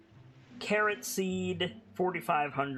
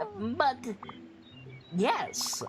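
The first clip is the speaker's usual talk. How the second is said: pleading merchant; 7 semitones higher, faster than usual. Acted.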